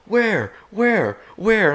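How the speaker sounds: high, urgent one-word questions; retold frantic searching. Buddies with rifles hunting for the bear, frantic high calls. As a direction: call it out high, frantically looking around.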